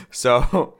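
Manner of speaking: ordinary talk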